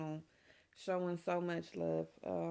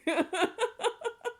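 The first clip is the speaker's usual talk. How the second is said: high laugh; 9 dB louder than usual. Real, not acted. Mom gushing over her baby.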